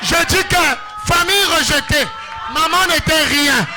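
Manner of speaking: high, loud declamation building in pitch; full-force preaching. Real, not acted. Preacher proclaiming God's glory to the congregation, full-force shouted preaching. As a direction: proclaim it in loud, driving phrases.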